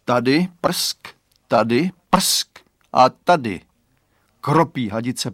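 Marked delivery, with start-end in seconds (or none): 0.0-3.6 loud spurts with spat sounds; sputtering hose. A narrator's reading. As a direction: splutter it like a hose spraying here and there. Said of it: "občas nespokojeně zaprskala". (prskající hadice)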